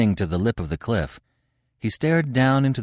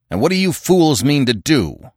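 raised, loud challenge; demanding; 5 semitones higher, 5 dB louder than usual. Acted. Conan challenges the angry tribesmen, demanding. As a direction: demand an answer from fools. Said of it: "demanded the Cimmerian".

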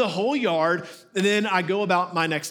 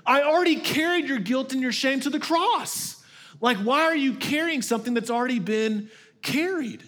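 Jesus amused; 7 semitones higher than usual. Retold or imitated. Jesus asking a believer why they still carry shame, amused disbelief. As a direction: play Jesus marvelling that you still carry it. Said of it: "Jesus is like".

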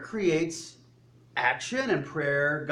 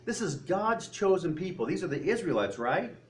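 normal talking voice

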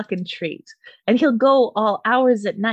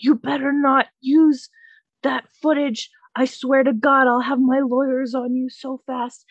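raised-pitch mock threat; hammed-up indignant threat; imitated yelling; 4 semitones higher than usual. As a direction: warn her like the ranting neighbor. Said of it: "He's yelling about how".

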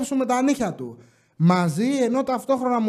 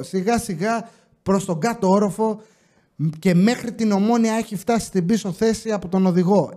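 ordinary delivery

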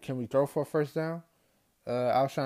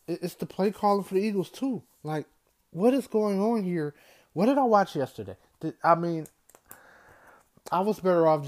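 exasperated outburst; 7 semitones higher, 5 dB louder than usual. Real, not acted. Fan venting about play calling.

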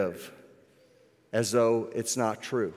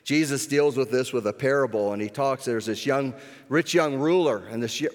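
normal talking voice

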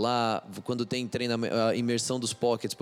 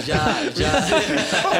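blurts it out through a big laugh, much higher and louder; laughing hard while answering; 13 semitones higher, 9 dB louder than usual. Real, not acted. Laughing at awkward translation joke. (rindo alto)

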